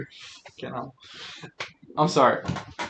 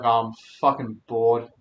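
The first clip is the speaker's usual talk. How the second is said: lower, slow, dragged-out boredom; mock bored; 5 semitones lower, 4 dB quieter than usual. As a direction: sigh it out like a bored ghost. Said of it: "you'd be like".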